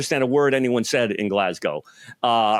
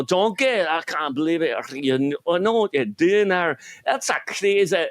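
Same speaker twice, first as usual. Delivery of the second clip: put-on thick, garbled Glasgow Scottish accent, pitched up; Shrek, Scottish accent; 6 semitones higher than usual. Glaswegian ogre talking to his donkey, thick Scottish accent. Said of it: "with the Scottish".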